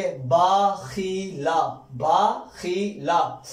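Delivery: very slow, drawn-out blend. Shown as a demonstration. Teacher modelling the joined word, very slowly. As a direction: stretch each syllable. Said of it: "teacher blends syllables slowly".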